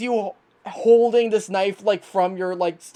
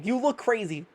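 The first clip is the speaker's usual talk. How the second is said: ordinary delivery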